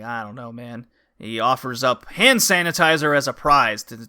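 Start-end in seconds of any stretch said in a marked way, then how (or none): 0.0-0.9 soft, quiet, unsure; mumbled reluctance. Retold or imitated. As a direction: mutter it, unconvinced. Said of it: "he's like".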